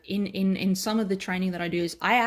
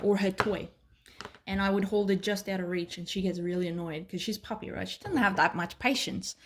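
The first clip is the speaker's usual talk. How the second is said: ordinary talk, nothing marked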